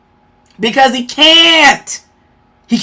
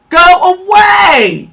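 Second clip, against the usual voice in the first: shouted go away; 5 semitones higher, much slower than usual. Real, not acted. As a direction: yell them out of the room. Dismissing the commenter.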